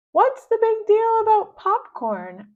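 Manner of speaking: squeaky baffled doll; doll Mary's voice. Acted. Mary to the girl, high loud puzzled voice.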